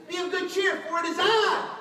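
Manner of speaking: loud, high proclamation; ringing preacher shout as Jesus; calling out. Acted. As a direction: call it out big, building on the last words. Acting Jesus calling to disciples.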